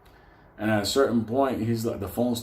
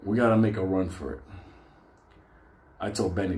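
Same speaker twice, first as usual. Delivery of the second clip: normal talking voice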